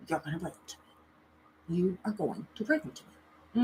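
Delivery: very soft, insistent order; acting out a quiet, intense command; retold hushed command. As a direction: order it under your breath.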